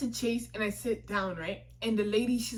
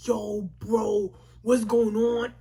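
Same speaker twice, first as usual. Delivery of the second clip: mock-panicked, louder toward the end; retold panic; 5 dB louder, much slower than usual. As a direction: act out alarm, building in volume. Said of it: "So I start to panic".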